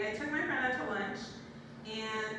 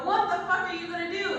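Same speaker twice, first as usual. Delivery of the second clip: blunt question; 6 semitones higher, 4 dB louder than usual. Retold or imitated. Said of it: "I would go".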